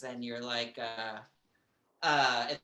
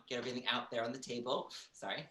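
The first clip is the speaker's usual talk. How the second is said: ordinary talk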